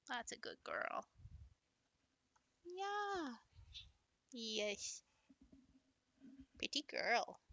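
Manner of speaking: quiet cooing to a dog; soft pet talk. Real, not acted. Praising her dog Willow in a soft coo.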